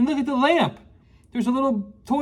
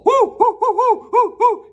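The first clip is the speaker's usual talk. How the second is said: high, loud hooting at burning porridge; hot-food hooting; 11 semitones higher, 4 dB louder than usual. Acted. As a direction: blow out the heat in sharp hoots. Porridge too hot reaction.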